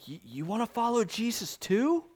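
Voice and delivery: lightly voiced mocking question; sarcastic. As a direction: toss the question back with sarcasm.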